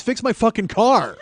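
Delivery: high, loud comic tirade; outraged rant. Acted. Customer venting about having to write Nissan, loud outraged rant. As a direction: yell it in indignant disbelief, building to the end. Inner rant at the car dealership.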